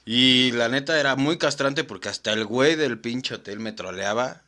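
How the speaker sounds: normal talking voice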